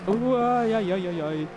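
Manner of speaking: sudden startled yelp, then flustered. Real, not acted. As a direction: caught off guard by a dropped phone. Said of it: "Drop my phone on the floor".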